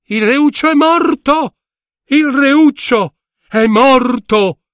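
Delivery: acuto e forte, un urlo di lutto; grido disperato. Acted. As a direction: annuncia la morte gridando in alto. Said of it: "si udirono pianti e grida". (la corte piange il reuccio: grido acuto disperato)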